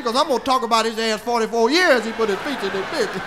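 ordinary delivery

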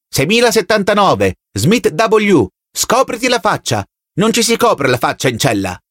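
high-pitched barked order, little extra volume; shouted command. A narrator's reading. Telescreen voice orders prisoner to uncover his face, shouting. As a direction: call out number, name and order in a high voice. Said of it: "gridò la voce dal teleschermo".

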